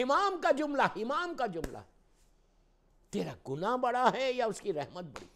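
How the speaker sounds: high, loud pulpit cry; ringing, emphatic sermon voice; impassioned preaching. Real, not acted. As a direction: drive the question home to the congregation. Preaches at full force.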